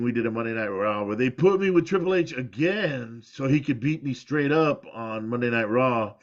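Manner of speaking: ordinary talk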